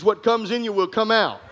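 usual speaking voice

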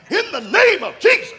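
loud, high, hammed-up Holy Ghost prayer; fervent prayer. Young pastor praying over the couple, mock-fervent and loud. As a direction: belt it out like a revival preacher. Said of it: "I started praying like I knew what I was doing".

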